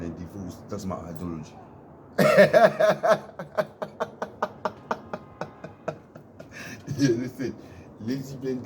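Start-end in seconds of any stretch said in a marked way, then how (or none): 1.6-6.9 long, high, loud cackle of laughter. Real, not acted. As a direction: really crack up. Laughs after own joke.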